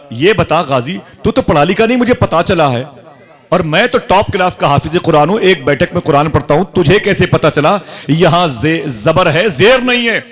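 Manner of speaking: loud, high-pitched challenge, climbing to a peak; indignant challenge. Acted. Learned reciter confronts the unlettered prisoner who corrected him, indignant challenge.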